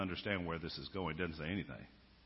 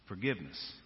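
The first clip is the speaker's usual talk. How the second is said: ordinary talk, nothing marked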